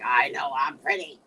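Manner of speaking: low, loud villain tone; evil voice. Acted. Woman answering men's compliments in a loud evil voice. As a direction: let the evil voice out, low and forceful. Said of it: "Then the evil voice comes out".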